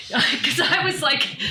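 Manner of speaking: high laugh spilling into the words. Real, not acted. [name] laughing over the doctor's brain remark, high laughing. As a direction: laugh through the line, amused.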